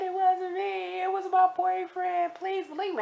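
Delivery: very high, sustained mock wail; imitated crying plea. Crying inmate blaming her boyfriend, imitated high wailing plea. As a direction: beg to be believed in a squeaky sob. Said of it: "please stop crying".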